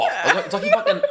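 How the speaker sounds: ordinary delivery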